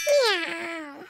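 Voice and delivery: a small kitten meowing. Acted. Girls turned into kittens.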